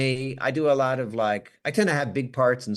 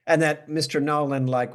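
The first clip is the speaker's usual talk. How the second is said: normal talking voice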